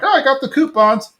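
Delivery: a podcast host mimicking the wife finding coupons, in a high voice; imitating Peggy. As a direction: pipe up brightly as Peggy would, just teasing. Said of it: "Peggy's like".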